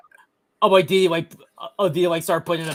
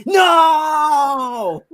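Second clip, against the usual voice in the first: mock scream; 10 semitones higher, 9 dB louder than usual.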